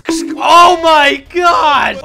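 very loud, high, drawn-out cry; stunned shout. Real, not acted. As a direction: yell it in total amazement. Reacting to a shocking dodge.

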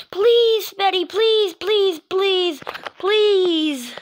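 high, loud, insistent pleading; beg, each please pushed out hard; desperate begging. Acted. Begging Betty for help, desperate and loud.

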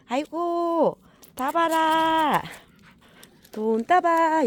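high, slow drawn-out call; high sing-song dog call. Real, not acted. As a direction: sing the name out high to fetch him. Calling the dog again.

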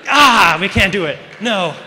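loud, high wail falling away; exaggerated loud give-up; mock despair. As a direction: cry out in hammed-up defeat. Acted failed attempt at self-mockery.

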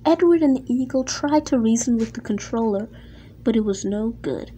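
usual speaking voice

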